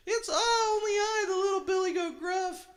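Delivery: very high, loud squeaky little goat; tiny little voice. A narrator's reading. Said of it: "answered the goat in a tiny little voice".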